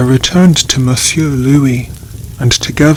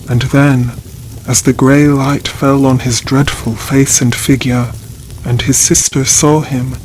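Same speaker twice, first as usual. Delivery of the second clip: ordinary delivery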